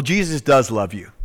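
usual speaking voice

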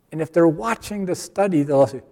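normal talking voice

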